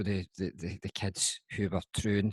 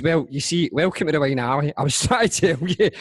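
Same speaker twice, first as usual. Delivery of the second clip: higher, louder, laughing through the words; laughing while quoting; 8 semitones higher, 9 dB louder than usual. Retold or imitated. Mum tells the teacher through laughter. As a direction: barely hold in the laughter. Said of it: "my mum just looked at her and says".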